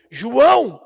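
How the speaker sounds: ordinary delivery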